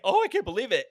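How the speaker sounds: a hint of fake shock; mock disbelief. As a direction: act surprised, a bit much. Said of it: "she was like".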